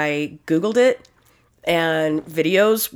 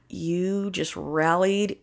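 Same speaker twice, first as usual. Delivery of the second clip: slow deadpan; 5 dB quieter, slower than usual. Real, not acted. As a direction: drag it out dryly. Deadpan punchline.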